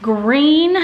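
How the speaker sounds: ordinary delivery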